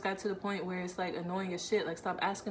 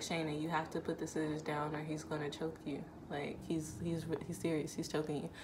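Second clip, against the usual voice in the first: softer urgent inner self-talk; 3 semitones lower, 7 dB quieter than usual. Retold or imitated. Woman being choked urges herself to drop the scissors, softly. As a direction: warn yourself under your breath. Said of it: "the mental conversation with myself".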